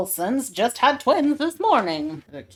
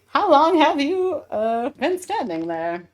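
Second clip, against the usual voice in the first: villain voice; 5 semitones higher than usual. Acted.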